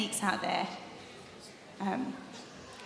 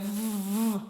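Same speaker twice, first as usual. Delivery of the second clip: rev sound; slower than usual. Shown as a demonstration. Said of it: "can everyone go".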